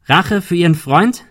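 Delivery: loud question, pitched high; calling out. A narrator's reading. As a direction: throw the question at him. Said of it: "rief Ben". (laut und hoch fragend gerufen)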